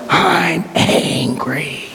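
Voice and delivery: deep-felt anger. Retold or imitated. Jackson taps into anger at his absent dad, agonized angry cry. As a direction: drag it out as anger dredged up from inside. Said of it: "you hear the anger".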